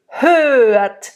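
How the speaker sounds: ordinary talk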